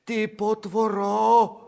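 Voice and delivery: high, sing-song coaxing of a nasty little dog; sing-song cooing to a lapdog. Acted. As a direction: coo it up high like baby talk to a pet. Narrator sweet-talks the dog.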